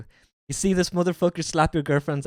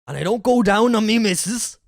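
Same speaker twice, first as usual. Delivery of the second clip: hammed-up West Country, rising louder; West Country accent; 4 semitones higher, 4 dB louder than usual. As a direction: play the West Country bloke, building in force. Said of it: "hurting my fucking voice doing this".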